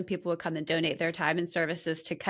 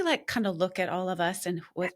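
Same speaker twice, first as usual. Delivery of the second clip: ordinary delivery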